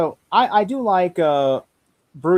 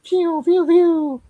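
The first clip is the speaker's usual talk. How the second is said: pew pew blaster sounds; 5 dB louder, much slower than usual. Retold or imitated. Imitating Iron Man blasters.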